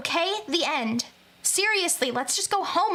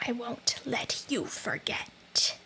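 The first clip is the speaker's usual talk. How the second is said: a slow menacing ghost whisper; ghostly whisper; 2 semitones lower, 6 dB quieter, slower than usual. Acted. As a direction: breathe it out like a ghost. Ghost voice, then hurts throat.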